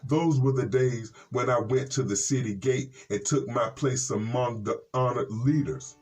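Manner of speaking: usual speaking voice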